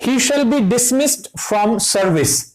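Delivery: very slow, word by word, like dictating; slow dictation. Shown as a demonstration. Teacher reading an example sentence to the class, very slow. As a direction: spell the example out slowly for students. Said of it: "और".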